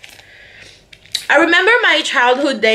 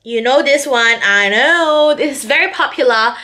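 ordinary talk, nothing marked

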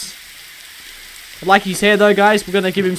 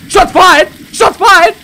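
alarmed shout; 11 semitones higher, 8 dB louder, slower than usual. Pretend. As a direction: shout it high and loud to the squad. Calling out a police shootout.

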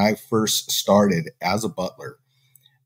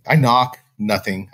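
ordinary talk, nothing marked

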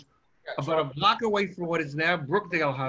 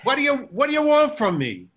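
higher, louder, a burst of Brooklyn temper; angry Brooklyn accent; 7 semitones higher, 5 dB louder than usual. Shown as a demonstration. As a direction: snap it out like an upset Brooklyn kid. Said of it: "if I get upset, it sounds something like".

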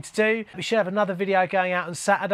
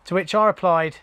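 ordinary delivery

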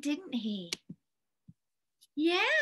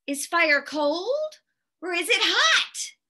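plain reading voice

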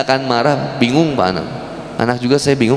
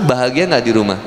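ordinary delivery

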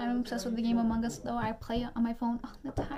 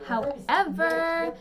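"however" stretched out slow, high and loud; theatrical emphasis; 5 semitones higher, 7 dB louder, much slower than usual. Real, not acted. Pivoting to palette doubts, slow loud theatrical "however". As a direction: draw out "however" dramatically. Theatrical turn in her thought.

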